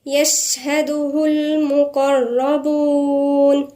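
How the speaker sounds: slow, melodic tajweed chant; measured Quranic recitation. Acted. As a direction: recite the verse slowly, drawing out the vowels. Recites the verse.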